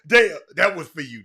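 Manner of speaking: loud teasing shout-out; calling out. Real, not acted. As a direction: holler the name to get attention. Calling a viewer after the song.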